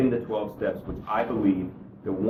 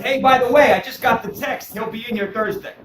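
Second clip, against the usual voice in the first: calling out; 10 semitones higher, 6 dB louder than usual. Retold or imitated. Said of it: "leans out the window and says".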